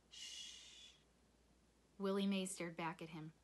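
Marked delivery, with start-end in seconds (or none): 0.1-0.9 long, soft shushing hiss; soothing shush. A narrator's reading. Willie Mae rocking Sistine, soothing shush. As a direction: hush her like a baby. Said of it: "rocking her and saying".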